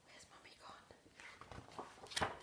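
slow scared whisper. A narrator's reading. Baby owl fearing mum is gone, slow whisper. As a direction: breathe it out slowly, frightened. Whispered line in dark wood.

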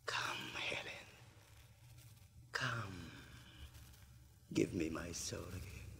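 whispering. Acted. Faustus whispering to Helen.

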